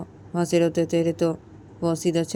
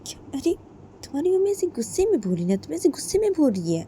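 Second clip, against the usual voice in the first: alarmed mother-in-law; 10 semitones higher, faster than usual. Acted. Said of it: "क्या बोलती है".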